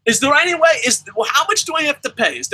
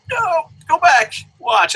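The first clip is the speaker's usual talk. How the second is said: exasperated yell; 6 semitones higher, 3 dB louder, slower than usual. Real, not acted. Ranting at Magnus directly.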